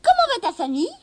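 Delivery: voix de lutin très aiguë; christmas elf. A narrator's reading. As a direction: lutin espiègle, perché très haut. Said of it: "demanda-t-il". (lutin à la voix très aiguë)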